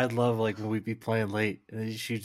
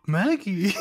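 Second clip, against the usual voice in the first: dad calling the dog; 10 semitones higher, 5 dB louder than usual. Retold or imitated.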